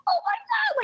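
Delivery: imitating a small excited scream; retold little scream. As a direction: squeal it like an overexcited fan. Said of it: "screamed a little bit".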